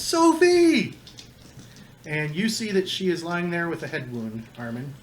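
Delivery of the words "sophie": high, loud cry of a name; screamed name. Acted. Game master as Edgar screaming for Sophie, high-pitched scream. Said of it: "Edgar screams".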